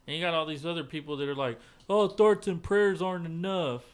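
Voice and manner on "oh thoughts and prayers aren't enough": higher, drawn-out mimicry of complainers; mocking protest. Host imitating critics of thoughts and prayers, mocking. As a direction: parrot the critics, slow and pitched up.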